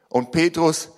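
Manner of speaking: ordinary delivery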